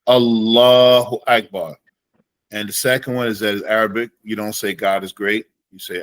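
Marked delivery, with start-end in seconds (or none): 0.1-1.8 slow, loud demo of the takbir; teacher modelling the takbir; Allahu Akbar said as a demo. Shown as a demonstration. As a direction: say it slowly and clearly, as taught. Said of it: "bring your hands all the way up".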